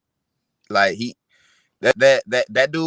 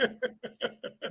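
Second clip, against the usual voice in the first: breathy laugh; 10 semitones higher, 17 dB quieter than usual. Real, not acted. Laughs at himself.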